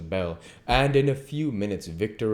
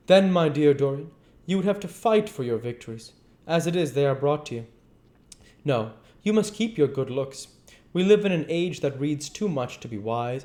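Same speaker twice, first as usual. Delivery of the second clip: raised, lively character voices; character dialogue; 8 semitones higher, 3 dB louder than usual. A narrator's reading. Reader voicing Henry and Dorian in high dialogue.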